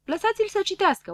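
high-pitched insistent plea; calling out. A narrator's reading. Mocked friends demanding the reading go on, calling out. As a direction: call it out, pitched well up. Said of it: "Grăbilă și Probabil strigau".

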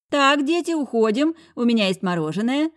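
high, loud bustling housekeeper; fussy housekeeper. A narrator's reading. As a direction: hurry the kids off brightly. Housekeeper hurries children away. (высокий суетливый голос экономки)

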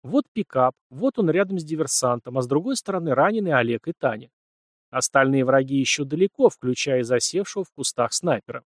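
plain reading voice

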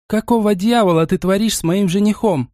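a touch of a furious fiancée; yelled. A narrator's reading. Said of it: "проорала Мила".

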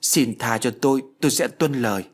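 quavering plea for mercy; frightened, submissive begging; trembling plea. Acted. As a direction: beg forgiveness in a trembling voice. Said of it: "giọng run rẩy".

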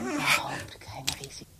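whisper. Acted. Lovers' secret talk.

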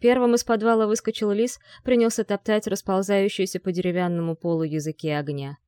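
ordinary delivery

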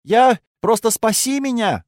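high, loud desperate plea; desperate cry. Acted. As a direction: beg for help, panicking. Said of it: "закричала она в отчаянии".

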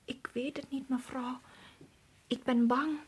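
barely audible, scared whisper; frightened whisper. Acted. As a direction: whisper it, too scared to speak up. Servant says she is afraid.

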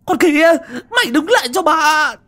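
high, loud yell in pursuit; angry shout. A narrator's reading. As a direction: order a runaway to stop, furious. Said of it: "Bà bật dậy mà đuổi theo hô".

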